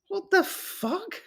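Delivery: real stunned gasp of disbelief. Real, not acted. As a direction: let it out in quiet high disbelief. Stunned by frailty.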